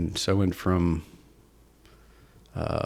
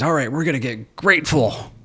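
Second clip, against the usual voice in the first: quoting Tony; 6 semitones higher than usual. Retold or imitated. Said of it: "And then he says".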